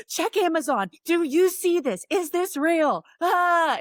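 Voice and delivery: high, breathless excited questions; excited disbelief. Retold or imitated. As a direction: gush to your best friend in disbelief. Retold excited call to friend.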